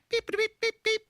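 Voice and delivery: high-pitched mock bleep beeps; high beeping. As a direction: squeak out beeps like a censor tone. Host mimics censor bleep sound.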